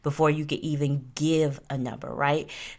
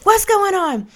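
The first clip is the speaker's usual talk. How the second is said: high-pitched, rapid-fire accusing questions; angry woman; 6 dB louder than usual. Retold or imitated. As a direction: play the jealous girlfriend grilling him. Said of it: "I hear this woman".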